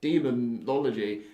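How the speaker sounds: ordinary delivery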